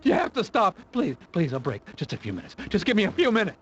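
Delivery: desperate pleading. Acted. Tortured man begging for a break.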